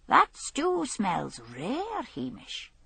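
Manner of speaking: slow, drawn-out appreciation; savouring. Acted. Hungry tramp to Hamish about the stew, slow and savouring. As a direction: linger over the smell.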